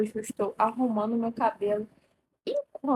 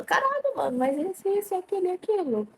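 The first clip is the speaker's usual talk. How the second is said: high, insistent quote of encouragement; high-pitched retold exclamation; 8 semitones higher than usual. Telling her ex his qualities, high-pitched exclaiming. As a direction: exclaim it high, imitating your past self.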